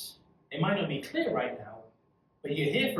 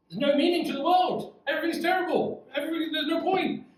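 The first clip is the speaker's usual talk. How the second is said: much higher hammed-up wail of despair; despairing Solomon; 11 semitones higher, 4 dB louder than usual. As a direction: play Solomon moaning that life is pointless. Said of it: "Get it out, Solomon".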